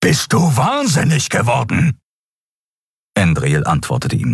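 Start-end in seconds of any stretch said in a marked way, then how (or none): 0.0-2.0 high, outraged question; outraged disbelief. A narrator's reading. Keru confronts the pilot over her reckless flight, outraged. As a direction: challenge someone who seems insane.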